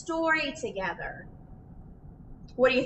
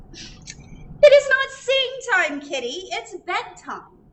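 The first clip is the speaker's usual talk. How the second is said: louder, higher, slow scolding; stern parent; 8 semitones higher, 6 dB louder, slower than usual. Acted. Parent telling kitty to stop singing, loud stern call. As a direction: scold the cat firmly.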